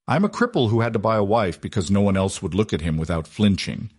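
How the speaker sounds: ordinary delivery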